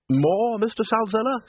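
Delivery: high, lilting offer; high-pitched dinner host; genial host. A narrator's reading.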